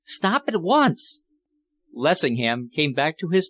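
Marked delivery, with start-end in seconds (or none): 0.0-1.2 high, slow insistent cry. A narrator's reading. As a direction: draw out the plea, voice raised high. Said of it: "Philippa cried".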